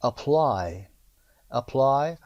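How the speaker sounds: Apply with the second syllable ply lifted and pushed; teacher hits ply in Apply; stress on PLY. Shown as a demonstration. Teacher models the word stress for students, stressing PLY.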